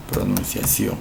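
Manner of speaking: Pronunciación whispered without voice. Shown as a demonstration. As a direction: breathe Pronunciación softly.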